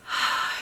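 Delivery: a calming sigh; relieved sigh. Retold or imitated. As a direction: let the breath out slowly.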